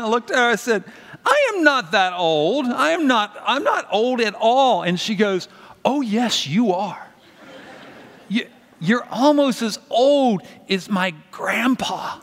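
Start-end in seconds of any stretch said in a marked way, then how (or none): 1.2-4.8 higher, slow, mock-offended denial; mock indignant protest. Uncle protests being called old. 5.8-12.2 put-on higher, slow, matter-of-fact child; a seven-year-old insisting sweetly; little niece voice.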